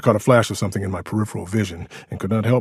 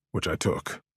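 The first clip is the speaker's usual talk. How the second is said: plain reading voice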